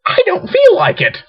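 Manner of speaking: high-pitched mock whine; whiny reluctance. Refusing to put on a belt, mock whining. Acting out own morning reluctance.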